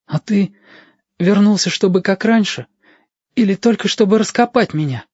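put-on young boy asking his returned friend; Stasik's child voice. A narrator's reading. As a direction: ask it like a hopeful young boy. Stasik questions returned Yashka.

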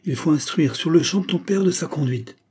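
high-pitched, urgent demand; agitated mother exclaiming; indignant exclamation. A narrator's reading. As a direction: press on with the outrage. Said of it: "s'écria madame de Géry".

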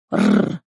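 very low rolled snarl; short, deep animal growl; beast growl. Acted. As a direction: rumble deep like a big cat. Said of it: "издал рычание зверь".

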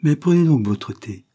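change the subject in a hurry; suddenly animated, pushing the tea on them; brisk, lively. A narrator's reading. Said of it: "continua-t-elle vivement".

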